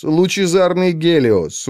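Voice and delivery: usual speaking voice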